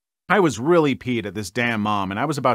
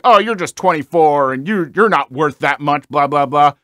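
louder, snide mock of the relatives; mocking imitation; 5 dB louder than usual. Imitating the dismissive relatives with a snide mocking tone. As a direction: sneer their words back at them.

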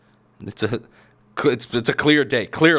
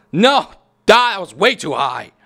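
loud, high cry of protest; sudden outburst at a failed move; frustrated yell; 6 semitones higher, 6 dB louder than usual. Real, not acted. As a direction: yell it as you lose the round. Crashes in the game.

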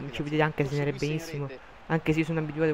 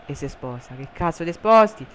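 ordinary talk

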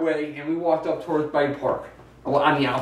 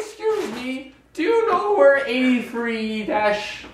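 put-on old lady voice; 8 semitones higher, much slower than usual. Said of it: "act like the old ladies".

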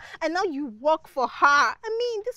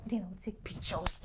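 low whispered boast; conspiratorial whisper; 10 semitones lower, 12 dB quieter than usual. Acted. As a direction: drop to a whisper to share the plan. Confiding plan for the visit.

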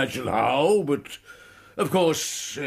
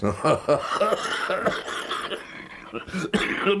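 a long airy chuckle; amused laugh; 4 semitones higher than usual. Acted. Professor amused by Holmes's smoking, airy chuckle.

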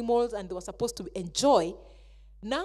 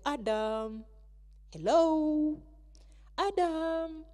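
calling out; 6 semitones higher, much slower than usual. Acted. God calling in the garden.

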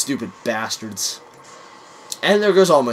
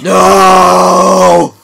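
long, loud, higher "No" cry; melodramatic wail; 5 semitones higher, 13 dB louder than usual. Pretend. Friend has done the deed, melodramatic long shout. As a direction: scream it out, drawn and hammed-up.

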